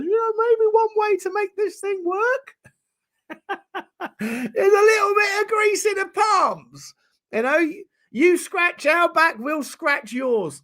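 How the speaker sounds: high put-on agent voice, slowing down at the end; mock football agent. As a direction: ham up a sly agent asking for a bribe, high and slow. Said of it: "his agent ... says".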